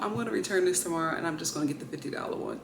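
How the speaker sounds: resigned mutter. Real, not acted. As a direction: say it under your breath, giving up. Calmer repeat after sigh.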